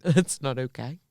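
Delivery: quiet, rising disapproval; disapproving. Real, not acted. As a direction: say it softly, disgusted. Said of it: "Why, why have you just said it like that?".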